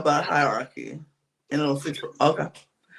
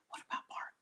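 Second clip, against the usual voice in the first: whispering; 23 dB quieter, faster than usual. Retold or imitated. Retelling whispered plotting.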